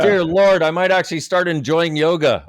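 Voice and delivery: laughing, loud and high; delighted laughter. Real, not acted. Delighted laughing response to pitch.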